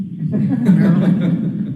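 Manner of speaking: amused short laugh; a quick chuckle. Real, not acted. Judge laughs at silent jurors.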